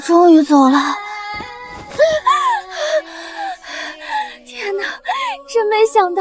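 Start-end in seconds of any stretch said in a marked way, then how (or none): 0.0-4.8 crying out with relief; the danger has passed and she breaks down; relieved sobbing. Acted. Relief after impostor leaves. (松了口气后哭出声)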